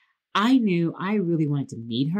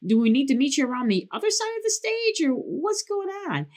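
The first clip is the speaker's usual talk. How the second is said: confused calling out; 9 semitones higher than usual. Retold or imitated. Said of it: "I'm like".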